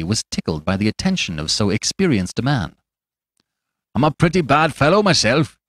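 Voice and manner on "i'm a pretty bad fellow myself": higher-pitched tavern braggart voice; coarse, boastful stranger. A narrator's reading. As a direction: boast as a rude rogue, pitched up.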